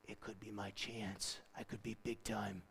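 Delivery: very soft, low musing; mock scheming under the breath; secret ambition. Voicing an ambitious thought he denies.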